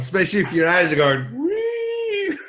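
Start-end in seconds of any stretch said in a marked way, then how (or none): 1.4-2.4 very high, long squealed "wee"; sustained high-pitched sound effect; mock dizzy whee. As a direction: squeal like eyes spinning round. Teasing imitation of spinning eyes.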